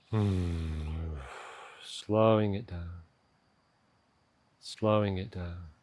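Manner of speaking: unhurried meditation voice with long pauses; guided meditation. Acted. Coach slowing a stressed listener's morning to a pause, calm guided meditation. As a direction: guide them gently down into stillness, leaving space to breathe.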